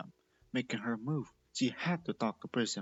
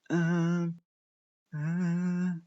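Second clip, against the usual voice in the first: panicked; 6 semitones higher, 7 dB louder than usual. Acted. Panicking in balloon.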